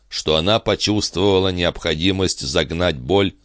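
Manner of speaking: ordinary delivery, nothing marked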